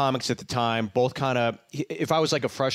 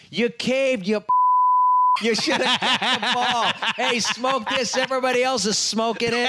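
much higher, loud mock heckle; heckling yell; 11 semitones higher, 4 dB louder than usual. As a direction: taunt the guy in front of the whole section. Burr heckling the fan.

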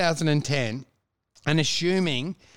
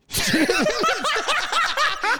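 high laugh. Real, not acted. Laughing at the joke.

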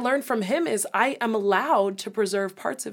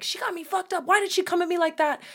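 high, indignant retort; mock outrage; 9 semitones higher than usual. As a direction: play the offended sister. Her own outraged reaction voiced.

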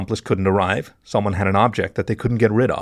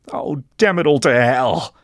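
slow, raised exasperated growl; higher-pitched, drawn-out angry outburst; growled curse; 5 semitones higher, 3 dB louder, slower than usual. A narrator's reading. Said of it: "he growled".

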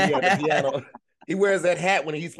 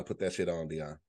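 quiet repeat; 10 semitones lower, 11 dB quieter than usual. Real, not acted. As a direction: lower and much softer, muttered repeat.

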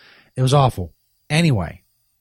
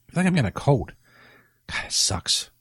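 ordinary talk

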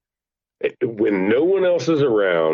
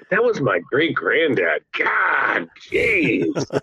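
exasperated great-grandkid; 6 semitones higher, much slower than usual. Pretend. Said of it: "you're like".

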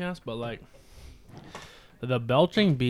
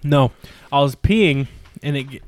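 ordinary talk

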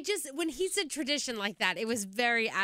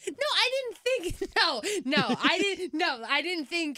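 laughing protest; 7 semitones higher, 3 dB louder, slower than usual. Real, not acted. Laughing denial.

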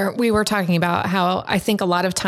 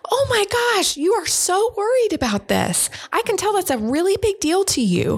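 high, matching their worried energy; matching the kid's tone; 13 semitones higher than usual. Shown as a demonstration. Parent mirroring a panicked kid, high matched tone. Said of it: "come in at maybe the, even the same tone of voice as they are".

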